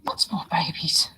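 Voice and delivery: soft whisper, slow. Real, not acted. Whispering to the camera over the scorpion babies, slow and soft. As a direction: hush so the babies aren't disturbed. Checking newborn scorpions quietly.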